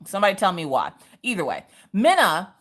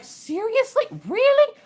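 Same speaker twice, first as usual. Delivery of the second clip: high mock disbelief; high sarcastic disbelief; incredulous. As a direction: act shocked at them, much higher pitch. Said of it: "I'm sitting there like".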